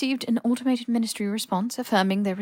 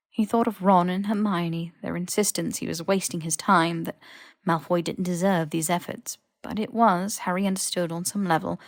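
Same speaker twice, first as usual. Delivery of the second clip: plain reading voice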